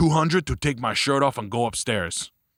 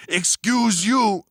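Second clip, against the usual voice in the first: drawn-out, slow, much higher mock-indignant excuse you; sassy retort; 9 semitones higher, 4 dB louder, much slower than usual. Girl snapping back at him after the shoe step, slow and high. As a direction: stretch it out high and snooty, mocking her. Girl's retort in quoted story.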